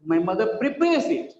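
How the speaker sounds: "prepares" peaks, then falls; stress on PREPARES. Shown as a demonstration. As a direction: insist on "prepares". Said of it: "it's not ready-made".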